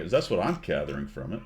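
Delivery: ordinary talk, nothing marked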